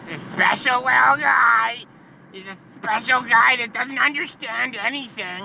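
put-on high, gushing baby-talk voice; patronizing public. Public talking about his buddy, mock condescending. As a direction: coo it high, as about a helpless child.

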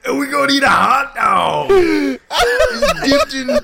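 high, loud, drawn-out ogre yell; Guy Fieri as Shrek. Pretend. Cohost topping the mock food-show bit, loud impression. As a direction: stretch the words in a hammed-up ogre bellow. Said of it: "Guy Fieri as Shrek".

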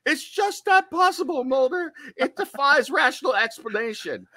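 high, falsetto impression of Scully; squeaky high mock Scully, laughing at the end. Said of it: "Scully ... saying".

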